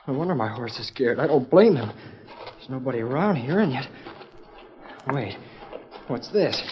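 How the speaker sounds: normal talking voice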